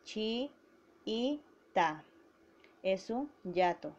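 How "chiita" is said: Chi-i-ta with a clear break between the two i sounds; syllable by syllable. Shown as a demonstration. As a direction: separate Chi-i-ta into three syllables: chi, i, ta. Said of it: "Vamos a silabiar".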